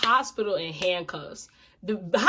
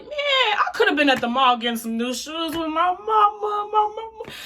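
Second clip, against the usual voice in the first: higher, wailing mock sob about her mama; imitating her crying plea; 10 semitones higher than usual. Thirteen-year-old her begging the cop, mock crying. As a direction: whine it like a scared crying kid. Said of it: "I'm sitting there crying".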